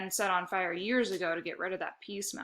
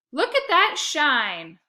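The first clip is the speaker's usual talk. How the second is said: slow, loud, higher proud boast; beaming; 7 semitones higher, 9 dB louder, much slower than usual. A narrator's reading. Boy beaming as he holds up Dad's shoes. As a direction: show it off, grinning. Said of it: "I beamed".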